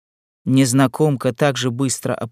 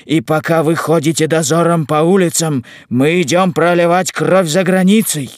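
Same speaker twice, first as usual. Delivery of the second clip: high, loud proud retort; ringing, raised-voice soldier's defiance; defiant young soldier; 5 semitones higher, 5 dB louder than usual. Acted. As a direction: declare it forcefully to the rival patrol. Loren's defiant speech to volunteers.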